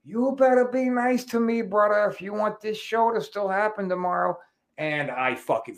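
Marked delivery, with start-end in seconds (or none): 0.0-4.4 higher, put-on wrestler voice with a taunt; imitating Jimmy's threat. Jimmy taunting the promoter from the doorway, higher put-on voice. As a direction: play the legend leaning out the door teasing. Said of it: "pokes his head out the door and says".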